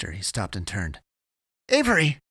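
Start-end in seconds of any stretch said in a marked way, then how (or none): 1.7-2.2 high, loud cry of a name; alarmed call. A narrator's reading. Eddie turns, sensing he lost Avery, alarmed call. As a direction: call back for someone lost. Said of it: "he called".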